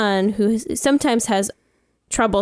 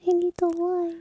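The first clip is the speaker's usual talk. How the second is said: light high-pitched echo; 9 semitones higher, 5 dB quieter than usual. Pretend. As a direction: sing it up high.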